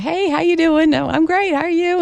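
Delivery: happy greeting. Acted. Acting out people greeting each other, happy and high-pitched. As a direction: greet them happily, pitched well up. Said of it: "a happy greeting".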